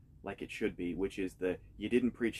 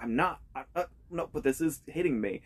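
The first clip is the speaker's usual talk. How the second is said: retold choked-up protest, pitched higher; holding back tears; 6 semitones higher, 5 dB louder than usual. Preacher recalling a sermon that hit him, holding back tears. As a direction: imitate fighting off tears, voice lifting. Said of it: "where I was almost in tears".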